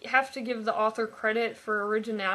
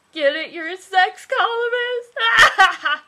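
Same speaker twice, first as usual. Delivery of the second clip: pushing the joke; 8 semitones higher, 10 dB louder, slower than usual. Real, not acted. Pushing her own joke.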